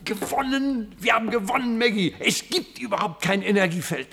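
high, elated cry of victory; triumphant joy. Acted. Professor telling Maggie they won, elated and high. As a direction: crow with relief and joy.

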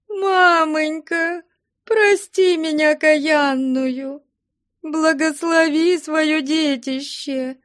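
very high, slow keening; wailing plea. A narrator's reading. Daughter at mother's feet begging a blessing, wailing. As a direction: draw out a high wail for forgiveness. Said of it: "голосила Просковья Потаповна у ног матери".

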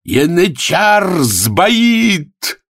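high, very drawn-out shout; cry of alarm. Acted. Spectator in the carousel sees the favourite falter, long cry. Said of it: "Крик".

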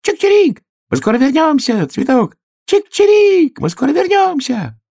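very high, loud bird calls; chirping sparrow. Acted. Sparrow calling back to the flower from the sky, very high. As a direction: chirp out cheerfully from above.

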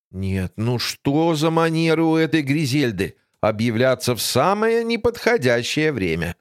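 usual speaking voice

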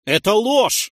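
high, loud shout of protest; outraged, rising shout; crying out. A narrator's reading. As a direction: cry out against the accusation. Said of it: "выкрикнул я".

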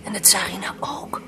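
astonished hushed whisper; amazed whisper at court. Acted. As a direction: whisper it aside in awe. Hushed aside in the palace.

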